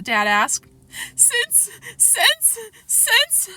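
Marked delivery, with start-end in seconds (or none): 1.1-3.5 high, halting sobbed words; sobbing stammer. A narrator's reading. Boy stammering to Dad through sobs, tearful. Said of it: "Fudge sobbed".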